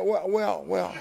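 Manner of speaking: high, stammered repetition; flustered. Retold or imitated. Flustered at the matching address.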